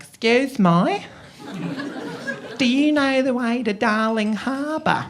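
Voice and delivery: put-on high, drawn-out Australian accent; Australian woman. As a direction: imitate an Australian woman slowly asking the way. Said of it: "this woman came up to ask for directions".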